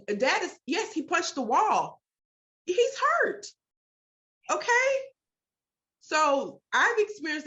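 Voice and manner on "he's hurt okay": heated. Real, not acted. Speaker arguing for a hysterical father, heated and insistent. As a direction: press the point hard, pleading the parents' case. Defending grieving dad's outburst.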